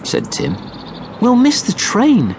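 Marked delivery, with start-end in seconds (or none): 1.2-2.3 much higher anxious little voice; a fretting small boy; worried child. A narrator's reading. Child worrying in story.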